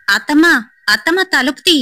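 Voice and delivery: high urgent call at the door; high pleading call for aunt. Acted. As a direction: call out to wake the house. Calling aunt to open door.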